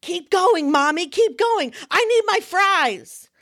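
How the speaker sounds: high, drawn-out whiny demand; whiny toddler. Retold or imitated. Toddler in the car seat demanding fries, high whine. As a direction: beg for fries like a hungry kid. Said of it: "to keep his big mouth shut".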